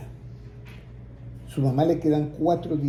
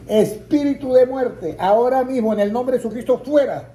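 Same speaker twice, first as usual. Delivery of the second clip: commanding rebuke; 8 semitones higher, 3 dB louder than usual. Retold or imitated. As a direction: cast it out with full authority. Rebuking death in prayer.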